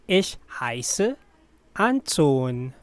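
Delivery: slow, pausing before the name; careful, spaced-out teaching model; slow repeat. Shown as a demonstration. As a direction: give it piece by piece. Teacher repeats slowly with pause.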